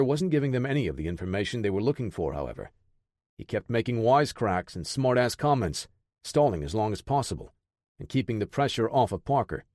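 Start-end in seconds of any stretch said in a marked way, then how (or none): none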